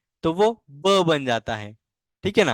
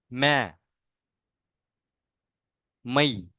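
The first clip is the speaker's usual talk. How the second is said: each syllable drawn out alone, long pauses; slow syllable drill; 3 semitones lower, much slower than usual. Shown as a demonstration. Teacher drilling syllables for pupils, slow and clear. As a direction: chant the barakhadi syllables slowly. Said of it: "reciting the ma barakhadi".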